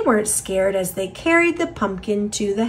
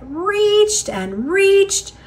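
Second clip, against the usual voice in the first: slow stretched reaching; 10 semitones higher, much slower than usual. A narrator's reading. Stretching upward.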